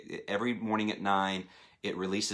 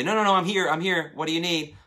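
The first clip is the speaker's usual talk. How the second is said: higher, louder, hurried reassurance; eager phone answer; 8 semitones higher, 6 dB louder than usual. Acted. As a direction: jump in eagerly to answer the caller. Acting out eagerly answering a wanted call.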